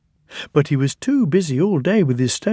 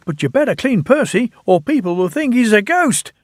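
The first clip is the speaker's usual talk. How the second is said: high, quick teasing railwayman; jolly signalman; 9 semitones higher, faster than usual. A narrator's reading. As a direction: rattle it off with a grin. Signalman voiced in story.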